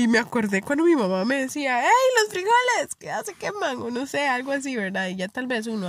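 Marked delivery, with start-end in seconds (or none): 1.8-3.8 mom calling out. Retold or imitated. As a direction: shout across the house like mom. Mom calling about burning beans.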